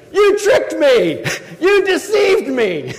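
high, loud, slow outraged shout; livid accusation. Acted. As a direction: cry out at the cheat. Jacob confronts Laban, livid.

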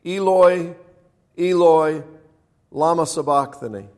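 high, loud cry to God. A narrator's reading. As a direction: cry out loudly, pausing between the words. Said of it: "Jesus cried out in a loud voice".

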